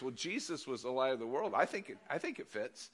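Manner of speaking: normal talking voice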